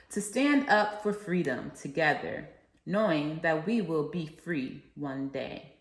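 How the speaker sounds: rousing oratory with a preacher's cadence; formal speech. Acted. Student reciting the I Have a Dream speech to listeners, rousing oratory. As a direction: proclaim each line like a civil rights speech to a crowd.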